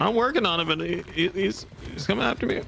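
high, flustered protest; panicky, stumbling over words; flustered alarm. Real, not acted. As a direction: plead while being chased. Chased while heading to gate.

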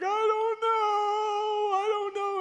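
very high, long drawn-out wail; squeaky mock bawling; imitated child crying. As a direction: howl it slowly like an inconsolable little boy. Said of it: "And he's crying and crying and crying".

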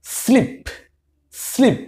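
teacher pronouncing স্লিপ for students to copy; modelled word. Shown as a demonstration. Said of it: "এরকম শব্দ উচ্চারণ করি".

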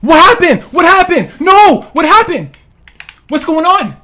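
loud, high, disbelieving yells; shocked shouting. Real, not acted. Gamer shocked at sudden death, shouting. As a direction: shout in shock at what just happened.

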